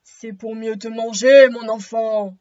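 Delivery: very loud, low wolf roar; big wolf voice. Acted. Wolf reveals himself to the girl, big loud low voice. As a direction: drop the disguise, boom it out low. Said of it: "avec sa grosse voix de loup".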